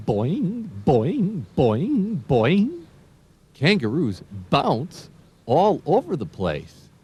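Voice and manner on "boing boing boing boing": high, springy boings; bouncing boing. Pretend. Teacher making kangaroo bounce sounds for kids. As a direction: bounce your voice like a kangaroo hopping.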